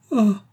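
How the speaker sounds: high, loud horrified cry; cri d'effroi. A narrator's reading. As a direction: gasp it out high, aghast. Mère horrifiée joint les mains. (exclamation aiguë d'horreur)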